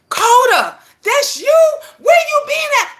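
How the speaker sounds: high and loud, delighted greeting; overjoyed to hear an old friend's voice; happy surprise. Retold or imitated. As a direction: greet a long-lost friend with joy. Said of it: "I'm happy to hear from her".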